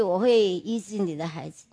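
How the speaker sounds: a hushed reassurance; soft promise. Retold or imitated. God's promise, quoted softly. (低声温柔地说出应许)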